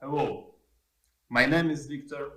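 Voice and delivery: ordinary talk, nothing marked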